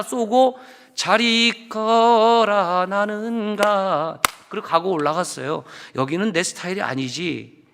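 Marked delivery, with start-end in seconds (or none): 1.0-4.2 very slow, drawn-out mock farewell; sing-song exit line, hammed up; dramatic farewell. Parting line after sermon. (과장되게 느린 작별 인사)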